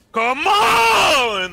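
long, high hype scream. Real, not acted. Caster screaming as game five starts, all-out yell. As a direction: scream it out at full force. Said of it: "I'm sorry, Joe, for screaming".

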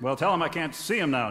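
normal talking voice

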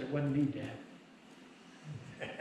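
low, very soft, slow retold protest; hushed mock-innocent child; imitating son's quiet denial. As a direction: play the boy denying it under his breath. Son denies it to his father.

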